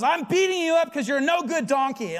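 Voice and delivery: mock indignant. Balaam answering his talking donkey, hammed-up indignation. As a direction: snap back at the donkey in comic outrage. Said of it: "He goes".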